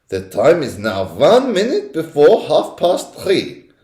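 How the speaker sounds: high, slow, deliberate witch voice; witch's announcement. A narrator's reading. Grand High Witch announcing the hour, slow and high. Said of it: "announced the Grand High Witch".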